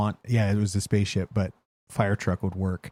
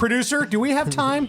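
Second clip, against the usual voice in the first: high put-on announcer voice; mock call to producer. Host jokingly asks the producer for time, high put-on voice.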